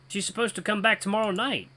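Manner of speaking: usual speaking voice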